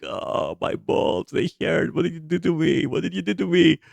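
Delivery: whispered groan that rises into a pleading whine; mock the guy clutching himself in pain; pained moaning man. Retelling the pacing guy, a half-whispered pained moan. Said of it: "and them going like".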